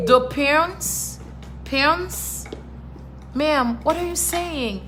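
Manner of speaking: insistent mispronouncing. Retold or imitated. Retold mangled word in a store.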